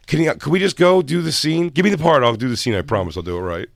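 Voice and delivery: loud, high plea easing down at the end; mock pleading. Auditioning actor to the casting room, begging to do the scene, mock pleading. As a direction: plead impatiently for the role.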